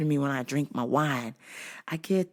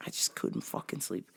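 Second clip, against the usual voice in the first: unvoiced whisper; whispered aside; 11 semitones lower, 14 dB quieter than usual. Real, not acted. Exhausted muttered aside.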